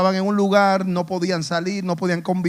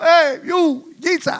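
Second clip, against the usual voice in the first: high, raised call for attention; voz alzada; 9 semitones higher, 4 dB louder, slower than usual. Acted. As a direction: call out to Jesus from a distance. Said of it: "alzaron la voz". (llamando a gritos, voz aguda)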